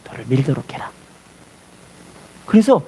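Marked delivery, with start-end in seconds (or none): 0.1-0.9 whispered echo of the command. Retold or imitated. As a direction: breathe the words without voice. Jesus's command repeated, unvoiced.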